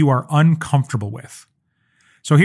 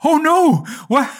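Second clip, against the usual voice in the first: alarmed surprise; 12 semitones higher, slower than usual. Retold or imitated. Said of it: "And I was like".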